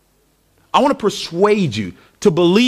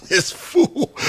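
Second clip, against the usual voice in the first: high scoffing aside; mocking crowd; 9 semitones higher than usual. Crowd muttering about the tightrope walker: high scoff. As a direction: scoff at the show-off.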